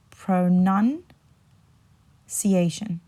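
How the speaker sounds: pronunciation drawn right out, NUN rising, pause, then ciation; very slow pro-NUN... ciation for pronunciation; slow careful demo. Shown as a demonstration. Teacher says it again for learners, pronunciation very slowly split after NUN. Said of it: "I'll say that again".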